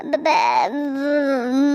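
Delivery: low, halting stutter; flustered stammer. Acted. Daughter-in-law searching for an excuse, stammering. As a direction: stumble on the first sound, hunting for an excuse.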